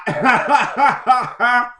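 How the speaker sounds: big burst of laughter, high and loud. Real, not acted. Laughing loudly at his own mock parent bit. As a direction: laugh out hard at the joke.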